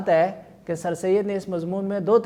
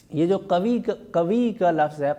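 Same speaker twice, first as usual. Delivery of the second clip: normal talking voice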